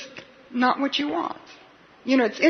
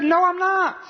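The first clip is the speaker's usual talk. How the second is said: high-pitched, emphatic protest; retold indignant denial; insistent denial; 9 semitones higher, 4 dB louder than usual. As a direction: deny it harder, pitch jumping right up. Peter's second, stronger denial, retold.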